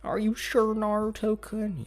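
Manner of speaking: very high, drawn-out put-on girl voice; girlish voice. Sasuke doubtfully asks Naruto about his promise, girlish voice.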